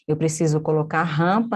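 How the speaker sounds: ordinary talk, nothing marked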